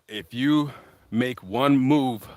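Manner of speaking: very slow, deliberate retold threat; measured threat. Mark threatening Jason at gunpoint, slow measured threat. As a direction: space out each word as a warning.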